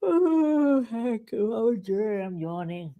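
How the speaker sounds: teasing voice of a bored Hank; mocking Hank. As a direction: imitate Hank yawning. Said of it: "Hank was like".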